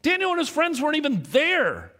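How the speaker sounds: high-pitched disbelieving protest; incredulous reaction. Retold or imitated. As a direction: blurt it out, stunned by what you just read. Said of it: "I read this and I go".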